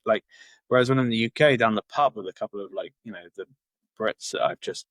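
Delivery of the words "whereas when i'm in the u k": normal talking voice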